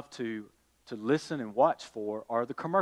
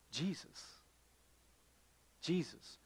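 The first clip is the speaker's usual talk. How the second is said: hushed answer; 16 dB quieter than usual. Acted. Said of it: "quiet answer after question".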